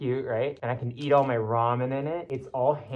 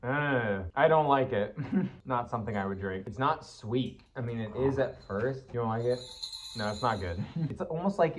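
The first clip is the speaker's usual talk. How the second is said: muffled inside costume head; speaking behind fursuit mask; through a mask head; 2 semitones lower than usual. Real, not acted. Seen 0: Sip came earlier; speaker wears a full fursuit head throughout.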